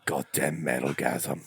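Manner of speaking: low, slow tough-guy growl; gravelly action hero voice. Retold or imitated. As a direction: growl it like a grim action star. Jason Statham voice, comedic bit.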